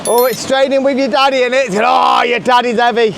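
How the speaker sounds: normal talking voice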